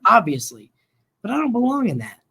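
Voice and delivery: ordinary talk, nothing marked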